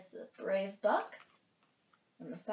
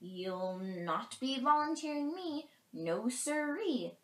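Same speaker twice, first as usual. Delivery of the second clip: a touch of fearful refusal, higher; scared rabbit; 5 semitones higher, 4 dB louder, slower than usual. A narrator's reading. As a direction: refuse as a mildly frightened rabbit. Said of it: "said the scared rabbit".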